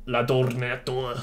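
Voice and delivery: "L'ordinateur" said slowly with French pronunciation; French word. Shown as a demonstration. Reading the French result to viewers in slow French. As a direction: give "L'ordinateur" a careful French sound. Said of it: "like that".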